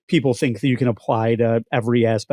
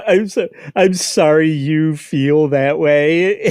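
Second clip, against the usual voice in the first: slow, drawn-out fake sorry; mocking, laughter breaking in at the end; mock apology; 4 semitones higher, 3 dB louder, slower than usual. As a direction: stretch out the hollow apology.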